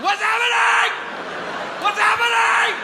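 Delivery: very high, loud wailing shriek; hysterical crying. Acted. Substitute teacher breaking down before the class, high crying shriek. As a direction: wail it in hammed-up tearful panic. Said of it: "Why are you crying so much right now?".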